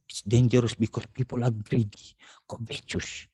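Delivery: hushed whisper of a grave warning. Acted. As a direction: lean in and whisper the danger. Quoting scripture's warning. (pabulong na babala)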